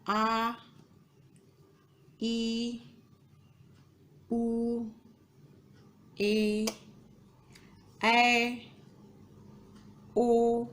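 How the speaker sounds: each vowel held long on a level tone. Shown as a demonstration. Teacher showing students long vowels, drawn out evenly. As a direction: draw out आ, ई, ए, ओ steadily. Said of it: "ह्रस्व स्वर का दुगना समय लगता".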